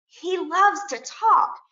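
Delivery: slow storytelling. Retold or imitated. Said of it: "So I tell my students".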